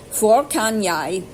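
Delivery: "Hvor kan jeg" said slowly and carefully; sounding out "Hvor kan jeg" for learners; Norwegian phrase read out. Shown as a demonstration. Learner reading phrasebook to viewers, slow careful "Hvor kan jeg". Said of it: "That is H V O R".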